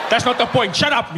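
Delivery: irritated rugby fan snapping. Retold or imitated. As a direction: tell him to shut up. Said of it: "fan snaps back".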